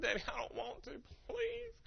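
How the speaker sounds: put-on very high, soft child whine; whining daughter. Daughter refusing cough medicine, high whine. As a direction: imitate a sleepy child pleading, high and small.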